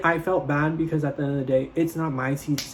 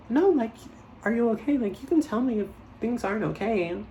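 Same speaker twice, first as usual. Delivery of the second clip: put-on higher, gently pressing girlfriend voice; insistent concerned friend; 8 semitones higher than usual. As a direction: coax her to open up.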